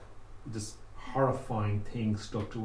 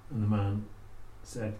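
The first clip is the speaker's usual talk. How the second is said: ordinary delivery, nothing marked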